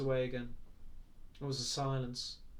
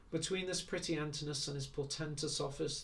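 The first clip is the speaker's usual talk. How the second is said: ordinary delivery, nothing marked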